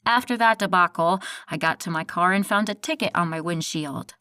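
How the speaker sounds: ordinary delivery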